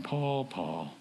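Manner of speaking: soft, low serious calling of the name; parent's quiet disappointed call; hushed parent's warning. Retold or imitated. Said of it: "That's your mom or dad going".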